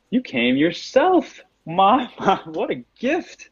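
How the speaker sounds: high-pitched villain in gleeful disbelief; surprised villain. Acted. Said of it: "there's a look of surprise on his face".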